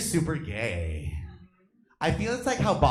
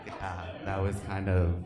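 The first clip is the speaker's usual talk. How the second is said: ordinary delivery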